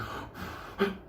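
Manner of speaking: imitation twitchy dog breathing; sleeping dog gasps. Storyteller mimics the dog being moved, breathy gasps. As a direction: gasp softly like a sedated dog. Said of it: "he's like".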